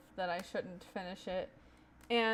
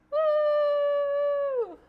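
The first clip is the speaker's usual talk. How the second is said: excited cheer; 10 dB louder than usual. Real, not acted. Cheering after song.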